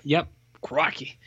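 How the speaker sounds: drawn-out mock Australian; slow, put-on Australian exclamation; Australian accent. Host confirming the impression, mock Australian accent. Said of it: "that's an Australian accent entirely".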